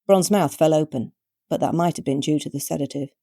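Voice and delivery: ordinary delivery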